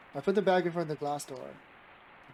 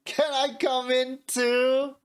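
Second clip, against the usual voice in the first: teasing; 8 semitones higher, 4 dB louder, much slower than usual. A narrator's reading. Teasing high question.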